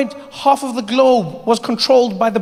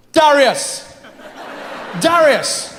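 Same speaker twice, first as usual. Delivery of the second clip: calling out; 4 semitones higher, much slower than usual. Retold or imitated. Troy calling Darius on the beach, calling out. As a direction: call your friend's name across the beach.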